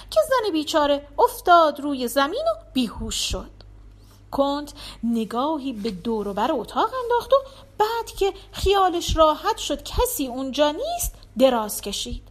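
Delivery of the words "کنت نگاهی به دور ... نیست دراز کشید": usual speaking voice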